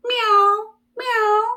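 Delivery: high, small meow; kitten meow. Pretend. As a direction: mew like a little kitten. Cat sound for children.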